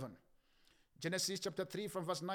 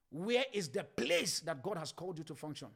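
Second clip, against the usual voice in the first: ordinary talk, nothing marked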